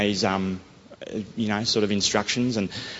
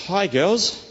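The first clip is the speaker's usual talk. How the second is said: bright greeting; 9 semitones higher than usual. Retold or imitated. Greeting strangers on the street.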